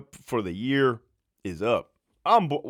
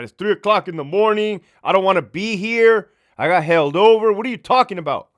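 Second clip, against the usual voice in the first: higher, louder exasperated complaint; fed-up, raised retort; 7 semitones higher, 8 dB louder than usual. Retold or imitated. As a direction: vent at the caller about the hour. Exasperated at the caller, 3 AM.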